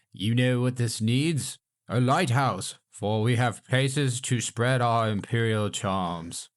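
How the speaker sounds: put-on light pompous British accent, slowing at the end; mock British accent. Mocking the colonial government, mild British accent. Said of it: "we have a British accent".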